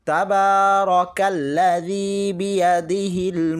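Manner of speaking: slow, drawn-out chanted recitation of the verse; slow Quran recitation. Shown as a demonstration. Teacher reciting the verse for students, slow and chanted. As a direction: recite it slowly, drawing out each vowel.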